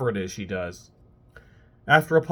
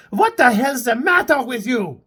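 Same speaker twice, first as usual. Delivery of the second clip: angry shout; 10 semitones higher, 7 dB louder than usual. Acted. Maëlle yelling at Kurt.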